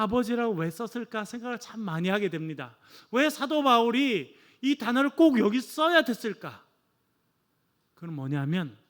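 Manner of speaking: ordinary talk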